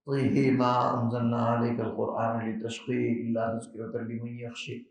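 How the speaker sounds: slow, soft Quran recitation with imala. Real, not acted. Recalling his recitation at the dinner, with imala. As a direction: recite طیہ with imala, slowly and quietly. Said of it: "امام حمزہ کی قرات کے پیش نظر امالہ کیا".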